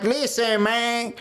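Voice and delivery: mocking Cuban-accented greeting; mock Scarface accent. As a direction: mimic a fake Cuban gangster accent. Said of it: "come back to the block talking about like".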